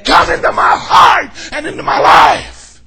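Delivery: slow, pleading shouted prayer; sinner's prayer. Retold or imitated. Sinner surrendering to God, slow pleading shout. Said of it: "When you come before God and you say".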